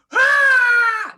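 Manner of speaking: high, loud imitated war scream; mimicking the heroine's furious scream as she falls; angry battle cry. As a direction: let out an angry fighting yell. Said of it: "like an angry".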